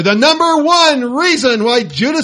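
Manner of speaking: milk the suspense of the final reveal; game-show host unveiling the top answer; big announcer reveal. Acted. Countdown finale after drum roll.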